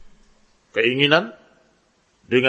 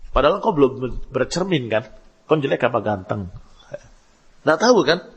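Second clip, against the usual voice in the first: ordinary talk, nothing marked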